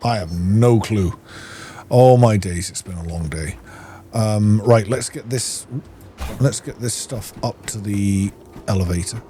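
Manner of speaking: ordinary delivery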